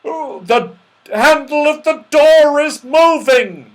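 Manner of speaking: loud, high cry of alarm; alarmed yell. A narrator's reading. Moonface sees the door handle move, yelling. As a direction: yell the warning. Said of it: "yelled Moonface".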